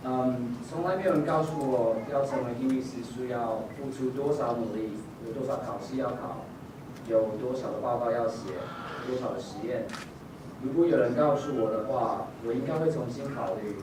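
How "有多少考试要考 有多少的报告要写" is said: usual speaking voice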